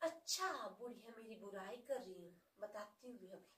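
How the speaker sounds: a sly quiet aside as the listening daughter-in-law; muttered aside. Acted.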